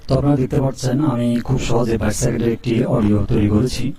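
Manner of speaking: ordinary talk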